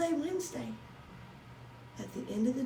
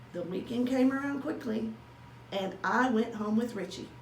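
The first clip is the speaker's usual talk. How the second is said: plain reading voice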